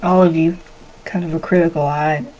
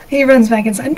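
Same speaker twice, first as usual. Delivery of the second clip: usual speaking voice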